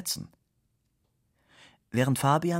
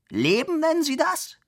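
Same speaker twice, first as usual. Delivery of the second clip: much higher, louder, scandalised cry; indignant outburst; 5 dB louder than usual. Acted. Director scolds Fabian, indignant high loud outcry. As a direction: shriek it in outrage.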